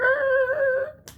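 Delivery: a sudden high-pitched cackle; high squealing laugh. Real, not acted. Vlogger cracking up at his own gunman story, squealing laugh.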